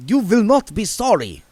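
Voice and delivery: put-on eager, accented reassurance; Otto vampire accent. A narrator's reading. Otto promising William in an Eastern European accent. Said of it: "said Otto".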